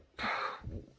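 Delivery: long exhale, lost for words; baffled puff of breath. Retold or imitated. Reacting to the stranger's strange claim, baffled exhale. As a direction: blow out a breath in disbelief.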